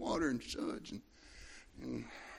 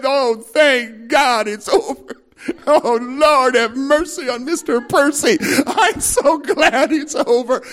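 relieved sigh of prayer; 11 semitones higher, 13 dB louder, slower than usual. Retold or imitated. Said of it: "you said".

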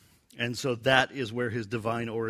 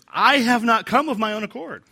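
proclaiming; 10 semitones higher, 9 dB louder than usual. Acted. Proclaiming Jesus' words.

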